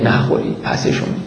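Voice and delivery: whispered urgently, barely voiced; hushed whisper. Zeynab whispers to the children not to eat the alms. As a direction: hiss it to the children under your breath.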